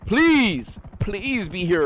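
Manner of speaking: pleading. Real, not acted. Calling for a missing viewer.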